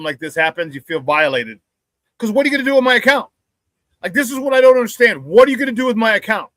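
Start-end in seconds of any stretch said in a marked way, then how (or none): 2.2-6.5 higher, heated questioning; indignant. Real, not acted. Host challenging whoever hacked his account, indignant. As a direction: demand an answer from the thief.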